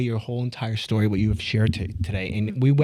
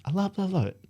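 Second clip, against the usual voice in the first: mock gushing; 6 semitones higher, 5 dB quieter than usual. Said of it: "I'm like".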